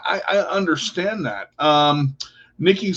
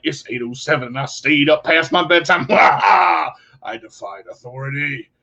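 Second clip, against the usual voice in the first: put-on playful teenage defiance. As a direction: crow it like a teen breaking bedtime rules. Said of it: "And you're like".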